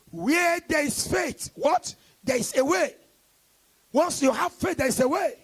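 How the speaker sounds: preacher calls out, waits for the crowd; call and response. Acted. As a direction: throw the line to the congregation.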